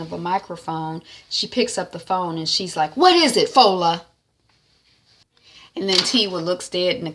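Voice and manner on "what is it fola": irritated. Retold or imitated. Answering phone irritated.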